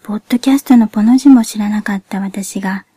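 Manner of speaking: ordinary talk, nothing marked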